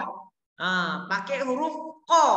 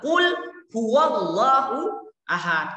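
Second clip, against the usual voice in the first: Quran recitation; 4 dB louder, slower than usual. Shown as a demonstration. Recites the verse slowly.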